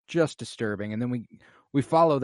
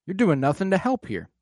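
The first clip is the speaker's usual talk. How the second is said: normal talking voice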